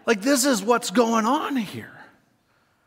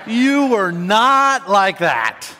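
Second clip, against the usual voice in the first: 1 mock scolding; 2 semitones higher, 8 dB louder, much slower than usual. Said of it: "I'm like".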